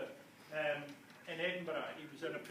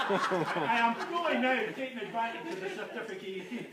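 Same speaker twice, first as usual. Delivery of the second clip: laughing; 4 semitones higher, 6 dB louder than usual. Real, not acted. Laughing through mock outrage.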